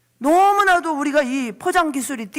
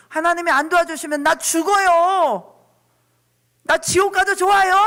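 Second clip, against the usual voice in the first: higher, louder frantic plea; desperate tantrum cry; 7 semitones higher, 4 dB louder than usual. Acted. 하나님께 떼쓰는 기도를 흉내 내는 목사, 높고 큰 절규. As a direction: throw a tantrum before God. Voiced tantrum prayer to God.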